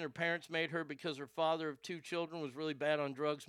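normal talking voice

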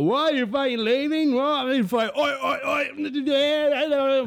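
high, loud imitated freakout; mock frantic outburst. Freaking out at the girl while tripping. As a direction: burst out in mock panic. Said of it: "Jag bara".

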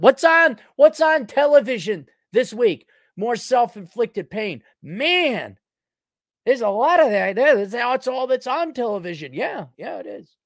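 squeaky high and loud, mocking. Sneering at self-inflicted pain on TV in a high mocking voice. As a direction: play a giddy TV fan in falsetto. Mock falsetto about television.

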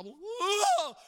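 high, loud wail of fury; imitated tantrum holler; mock rage yell. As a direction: let out a hammed-up enraged howl. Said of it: "It used to infuriate me".

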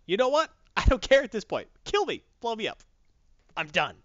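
high, exasperated mock outburst; loud, hammed-up had-enough rant; fed-up defiance. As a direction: act someone quitting and daring the boss. Alfred quitting, daring Batman.

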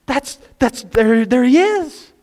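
high, slow, thrilled exclaim; excited spotting. Retold or imitated. Parents finding their boy in the crowd, high thrilled cry. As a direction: point him out breathlessly.

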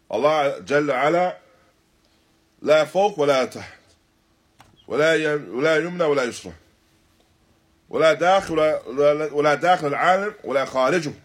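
ordinary delivery

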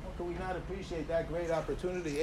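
ordinary talk

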